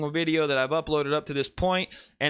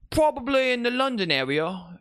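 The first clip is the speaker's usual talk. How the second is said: a quick, jokey put-on London accent, pitched up high; shitty British accent; 9 semitones higher than usual. Joking to his stream about a viewer from the UK, in a mock British accent. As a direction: toss in a bad British accent as a joke. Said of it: "any excuse to do a shitty British accent".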